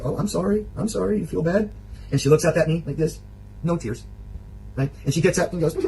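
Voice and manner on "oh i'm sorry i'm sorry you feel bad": higher-pitched mock-soothing apology; lightly imitated sympathetic sorry; retold apology. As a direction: act out placating a sulker. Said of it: "And I stop and I say".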